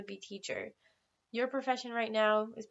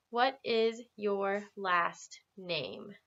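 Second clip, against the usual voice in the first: very slow, clear teacher modelling; slow model question; much slower than usual. Shown as a demonstration. Teacher modelling a question slowly for students. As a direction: lay out each word for the class. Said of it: "say that with me".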